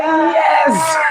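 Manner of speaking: high, thrilled squeal of yes; retold excitement. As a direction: replay your delight, breaking into laughter. Retold thrill at new subscriber.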